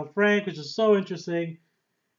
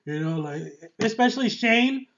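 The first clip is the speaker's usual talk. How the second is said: ordinary delivery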